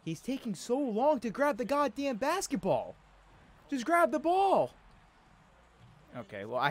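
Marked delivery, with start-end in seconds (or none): 0.0-4.7 high, loud, fed-up yelling; exasperated yell. Real, not acted. Fed up with slow player animation.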